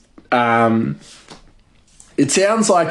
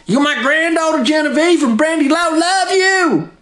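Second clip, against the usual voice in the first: much higher put-on voice of a doting hillbilly grandma; 11 semitones higher than usual. Hoarder grandma bargains with her granddaughter, high put-on doting voice. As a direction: coax the granddaughter like a fond old grandma. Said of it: "And she's like".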